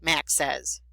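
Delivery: ordinary delivery, nothing marked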